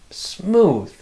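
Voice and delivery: usual speaking voice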